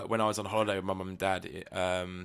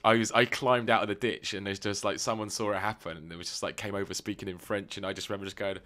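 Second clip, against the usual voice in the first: ordinary talk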